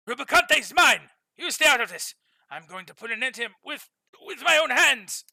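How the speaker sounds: angry shouting. Acted. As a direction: shout it like a furious prince. Prince furious at rival.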